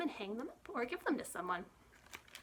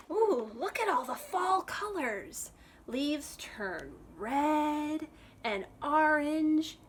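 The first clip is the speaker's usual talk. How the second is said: wonder; 5 semitones higher, 4 dB louder, much slower than usual. Acted. Marvelling at pictures.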